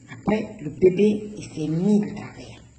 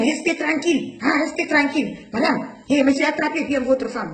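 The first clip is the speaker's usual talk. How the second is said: higher mock yell at the patient; imitating the doctor yelling; 7 semitones higher than usual. Doctor ordering the woman in labour to keep still, mock yell. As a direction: snap orders at her like the impatient doctor. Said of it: "il crie, hein".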